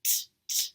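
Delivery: unvoiced t, t, crisp puffs; t sound. Shown as a demonstration. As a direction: tap out t, t, clearly. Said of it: "starts with a t".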